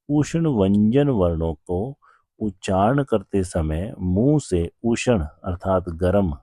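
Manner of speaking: normal talking voice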